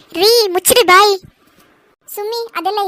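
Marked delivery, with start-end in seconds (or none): 0.1-1.2 loud furious order to shut up; angry shout. Acted. Wife shouts at her husband to shut his mouth. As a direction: shut him up, hard.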